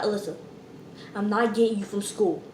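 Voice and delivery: low, soft refusal, lightly voiced mom; unmoved mother. Mama refusing to pick up her daughter, unmoved.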